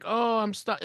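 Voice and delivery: high mock-helpless whine; put-on damsel voice; stuck damsel. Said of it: "And she's like".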